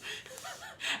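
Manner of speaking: a sudden high giggle fit; high squealing laugh. Real, not acted. Laughing at her own clumsy fall, high squeal.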